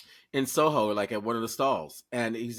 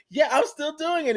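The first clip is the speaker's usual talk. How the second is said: excited reply; 6 dB louder than usual. Retold or imitated. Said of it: "And I was like".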